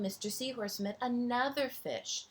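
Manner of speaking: plain reading voice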